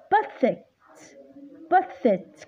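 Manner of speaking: بثت with a weak schwa first vowel; pronunciation shown. Shown as a demonstration. Tutor modelling the reduced vowel for the student. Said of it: "ينلفظ الشوا".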